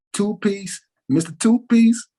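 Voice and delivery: retold boast of the fighter, pitched high; imitating the fighter. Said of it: "he said".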